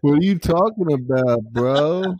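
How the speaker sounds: put-on high stoner voice; laid-back pothead, hammed up. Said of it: "the high stoner [name] voice".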